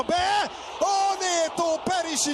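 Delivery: high, slow shout; excited shout. Real, not acted. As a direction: cry out at the save. Big save in play-by-play.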